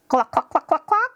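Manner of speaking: quick run of clucks; imitated chicken noise; chicken clucking. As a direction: cluck rapidly like a hen. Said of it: "Chicken goes".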